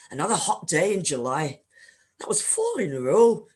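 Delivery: put-on a self-confessed clumsy Scottish accent; bad Scottish accent. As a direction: try a Scottish accent, hammed a little. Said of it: "imagine a much better Scottish accent".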